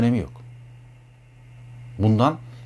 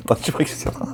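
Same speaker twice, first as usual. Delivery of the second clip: breathy laughing whisper; 2 semitones higher, 3 dB quieter than usual. Real, not acted. Amused at the idea of a permanent lover, whispering through a laugh. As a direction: laugh it under your breath. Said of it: "laughing whisper".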